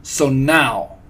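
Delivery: loud, commanding witch; forceful villainess announcement; barked. A narrator's reading. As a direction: bark it to seize attention. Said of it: "barked the Grand High Witch".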